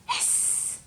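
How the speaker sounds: long unvoiced snake hiss. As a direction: hiss like a sneaky snake at the door. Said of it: "just like a snake".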